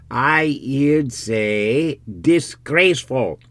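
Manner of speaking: drawn-out rustic insistence; rustic villager. Acted. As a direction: report it slowly and emphatically in dialect. Villager reports the overheard word.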